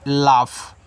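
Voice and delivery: लाफ drawn out slowly and low as "laaf"; teacher modelling the word; model pronunciation. Shown as a demonstration. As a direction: show how to say लाफ, slow and clear. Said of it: "इस तरीके से".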